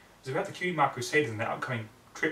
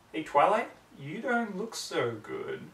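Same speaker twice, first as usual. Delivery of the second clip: higher, slow eerie voice; concerned friend; 5 semitones higher, much slower than usual. Acted. A friend checking on Twilight, higher and slow. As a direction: ask slowly and strangely.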